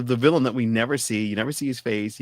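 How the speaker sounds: ordinary talk, nothing marked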